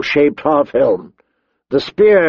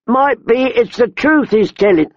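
high-pitched rustic robber woman; woman brigand; 10 semitones higher than usual. A narrator's reading. Tall woman to companion about the boy, musing. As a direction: muse aloud in a high woman's voice.

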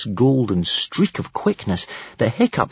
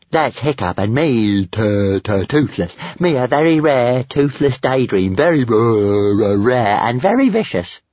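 gruff, stammering little dragon; 2 semitones higher, 4 dB louder than usual. A narrator's reading. Toothless shows off to Stormfly, gruff and stammering. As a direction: boast gruffly, stuttering on first sounds. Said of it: "He answered gruffly".

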